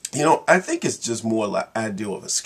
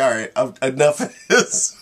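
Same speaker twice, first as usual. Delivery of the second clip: hungry lion; 3 semitones higher, 6 dB louder than usual. Retold or imitated. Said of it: "the lion gets hungry and say".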